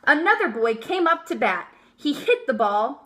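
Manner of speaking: plain reading voice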